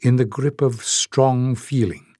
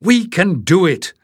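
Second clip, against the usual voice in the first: higher, louder, pressing hard on We; urging; 7 semitones higher, 4 dB louder than usual. A narrator's reading. Leif urges his friends to try, eagerly. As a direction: push them to believe. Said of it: "Leif urged".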